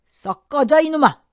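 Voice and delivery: high, loud bellow of dismissal; explosive angry yell; furious shout. A narrator's reading. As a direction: roar at him to get out. Father-in-law throws son out.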